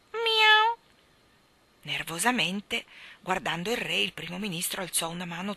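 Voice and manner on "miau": high, loud mewing meow; sharp high cat cry. Acted. Cat meows.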